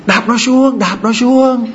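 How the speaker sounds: mock relatives yelling from above; mock shouting relatives. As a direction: egg her on, mock rough shout. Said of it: "ông, bác, chú, bà thím".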